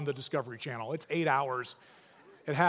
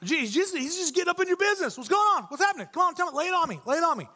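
high, loud, fast pushy questions; pry eagerly, way too close; intense nosy friend; 10 semitones higher, 3 dB louder, much faster than usual. Pretend. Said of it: "A little intense for me".

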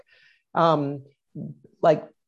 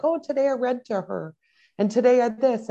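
put-on higher-pitched take on an eight-year-old girl; imitating Sophia; 7 semitones higher than usual. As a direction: voice a child reporting on her school day, pitched up. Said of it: "she'll come home and be like".

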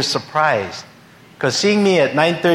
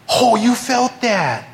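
amazed neighbor; 9 semitones higher, slower than usual. Retold or imitated. Neighbor amazed after the prayer.

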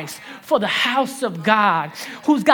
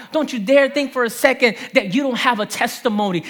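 ordinary talk, nothing marked

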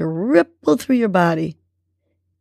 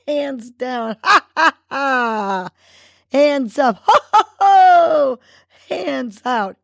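laughter exercise; 6 semitones higher, slower than usual. Shown as a demonstration. Host laughing along with struggling listeners, exuberant ho ho and ha ha. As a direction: chant the laughs big and let them turn real. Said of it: "try this approach with me".

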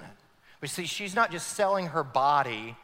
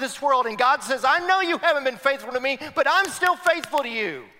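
full-force preaching; 11 semitones higher, 8 dB louder than usual. Acted. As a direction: cry out God's love to the church.

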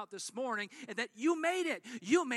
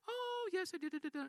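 much higher, airy phone chatter; chatty lady on phone; 9 semitones higher, 3 dB quieter than usual. Retold or imitated. Preacher voicing a lady gabbing on her phone, high and chatty.

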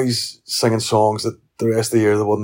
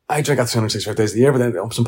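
usual speaking voice

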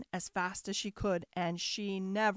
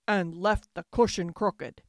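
loud, big bear growl; growling father bear; 3 semitones lower, 8 dB louder than usual. A narrator's reading. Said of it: "growled the father bear in his great big voice".

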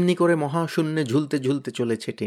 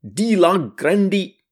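high, louder cheer of victory; triumphant yell; 4 semitones higher, 5 dB louder than usual. Acted. Tenida cheers the kite as it soars, triumphant yell. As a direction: whoop it out proudly. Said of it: "Tenida চেঁচিয়ে উঠল".

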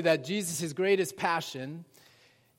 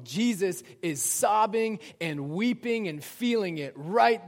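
rising, louder sermon appeal, slowing to reassure; impassioned preaching; 5 semitones higher, 6 dB louder than usual. Acted. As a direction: preach it out to a hurting congregation. Preaching build to comfort.

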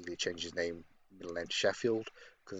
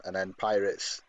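usual speaking voice

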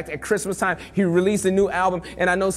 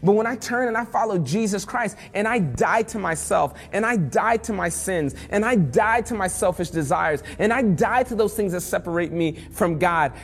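usual speaking voice

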